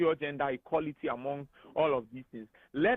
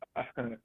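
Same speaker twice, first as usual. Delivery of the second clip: short, soft real chuckle; amused chuckle; 3 semitones lower, 5 dB quieter than usual. Real, not acted. Guest reacting to the interviewer's gender question, amused chuckle. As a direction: let out a brief low laugh. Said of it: "I don't know why you are laughing".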